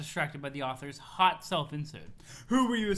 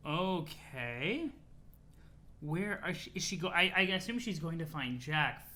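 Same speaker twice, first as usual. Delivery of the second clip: ordinary talk, nothing marked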